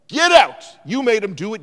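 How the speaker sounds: furious shout. Retold or imitated. Youth pastor ordering the narrator out, imitated angry yell. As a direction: bark him out the door. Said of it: "Like he lost his mind".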